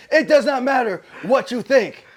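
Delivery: loud laughing echo. Real, not acted. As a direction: repeat it while cracking up.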